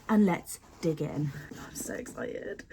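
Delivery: slow breathy whisper of excitement; excited whisper. Real, not acted. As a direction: whisper it slowly, barely holding in the thrill. Opening the box, hushed.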